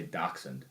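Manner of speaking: "dachshund" corrected to DOK-sund, softly. Shown as a demonstration. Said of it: "I think that you say".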